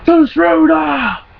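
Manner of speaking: high, drawn-out holler; yelling out window. Retold or imitated. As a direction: yell it like a car-window prank. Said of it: "stick his head out and yell".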